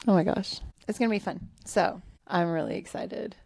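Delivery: ordinary delivery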